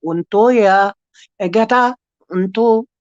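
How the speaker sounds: high, lilting star language; short invented phrases, each lifted in pitch; speaking in tongues. Shown as a demonstration. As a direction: intone strange syllables like an unknown tongue. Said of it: "That's what mine usually sounds like".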